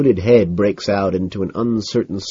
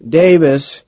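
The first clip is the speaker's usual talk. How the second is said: higher, louder exasperated address; patient sigh; 8 semitones higher, 7 dB louder than usual. A narrator's reading. Carnes sighing at Davis, strained patience. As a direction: say it as if explaining to a child. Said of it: "he sighs, as if patiently trying to explain".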